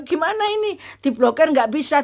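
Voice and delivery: high, helpless wail of worry; retold panic. Mother finds the bank account cannot be blocked, panicking. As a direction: re-enact being at a panicked loss.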